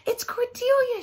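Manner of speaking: high excited shout. A narrator's reading. Mister spotting the lost dog, excited shout. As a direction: shout it out, thrilled. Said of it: "shouted Mister".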